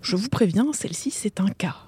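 a hushed confidential whisper. Acted. Secretary whispering a warning to the doctor.

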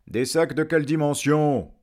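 high, loud question; demanding villagers. Acted. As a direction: call it out from the crowd. Men loudly press the dragon. (question lancée fort par la foule)

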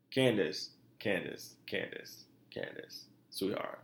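spoken, fading to a whisper; condescending, fading from speech into whisper. Real, not acted. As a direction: talk down to her, fading out.

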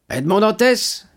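high, firm official's voice; stern commissaire. Acted. Commissaire répondant fermement à monsieur Morel. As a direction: play the police commissary, stiff and formal.

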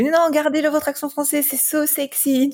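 high-pitched, imitating a charmed listener; playful high voice of an enthusiastic fan; gushing admirer. Acts out an admiring client.

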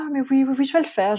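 high breezy yes yes; mimicking husband. Wife mimicking her husband's careless promise, high and breezy.

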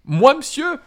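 overjoyed disbelief; Sam exclaiming. A narrator's reading. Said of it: "s'écrie Sam". (Sam s'écrie, stupéfait)

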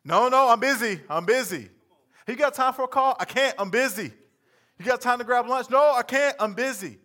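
loud high brush-offs; busy brush-off. Acted. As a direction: fire off busy excuses. Acted busy conversation.

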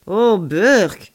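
loud, drawn-out exclamation of disgust. A narrator's reading. Alien seeing roast meat on screen, disgusted. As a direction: recoil with a big "yuck". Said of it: "s'exclama Amy avec un air de dégoût".

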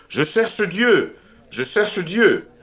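high, loud, slow crying out; madman's cry. A narrator's reading. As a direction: call out desperately across the square. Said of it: "en criant sans cesse".

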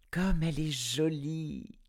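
put-on high, delighted child's exclamation; child voice admiring her new parasol; delighted little girl. A narrator's reading. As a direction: gush like a little girl over a gift. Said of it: "s'exclama l'enfant".

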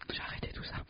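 hushed unvoiced mock confession; whispering. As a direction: whisper it like a secret. Mock-solemn aside.

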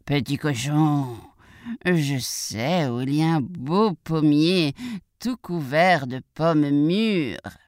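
slow, drawn-out wolf voice; sly wolf. Acted. Wolf tempts the piglet with an apple tree, slow and coaxing. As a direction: stretch the words to tempt the prey.

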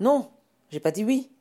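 loud, high-pitched protest; exclamation. Acted. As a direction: cry out in objection. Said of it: "s'exclama Jacques". (exclamation indignée)

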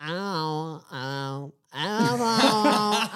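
whiny breathy wailing "ah"s; mock wailing singer. Mocking today's singers with wailing "ah"s. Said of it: "chantent toutes avec une voix du style".

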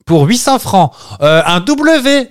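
high, slow mock host announcing the letter; playful game show host impression, high and drawn out. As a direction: ham up the TV host calling a letter.

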